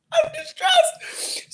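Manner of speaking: very high crying squeal; mock crying wail. Vlogger mocking her own despair over cake prices, fake sobbing wail. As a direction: wail it like a hammed-up sob.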